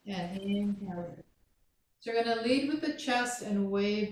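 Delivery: soft, calm class instructions; yoga cueing. Acted. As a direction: guide the student gently through the flow. Talks Manny through the sequence.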